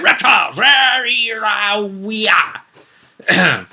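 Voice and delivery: high, shouted mock monster roar; silly shouted lizard war cry; roaring reptile. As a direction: bellow like a proud dinosaur. Mocking dinosaur-era reptile pride.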